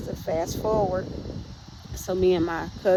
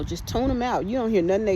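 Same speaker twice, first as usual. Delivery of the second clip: usual speaking voice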